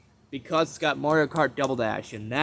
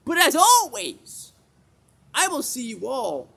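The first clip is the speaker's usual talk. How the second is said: stretched high sing-song sign-off; high outro voice; 12 semitones higher, 6 dB louder than usual. Acted. Failed outro, high slow voice.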